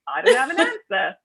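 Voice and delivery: hesitant Australian twang, lightly done; Australian accent. Retold or imitated. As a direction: imitate an Australian backpacker caught off guard. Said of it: "in his Australian accent".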